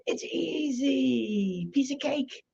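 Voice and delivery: high, soft sing-song reassurance; breezy pitch-man voice; mock easy. Mock sing-song selling ease.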